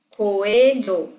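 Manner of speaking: COELHO drawn out beat by beat, "co - e - lho"; dividido em sílabas. Shown as a demonstration. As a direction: say Coelho syllable by syllable. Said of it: "uma palavra é maior que a outra". (Coelho separado em sílabas, co-e-lho)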